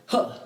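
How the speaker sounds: short voiced huh grunt; a quick punchy grunt to mark the bow pop; little grunt. Shown as a demonstration. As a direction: demo grunt on the beat. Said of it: "with a little grunt".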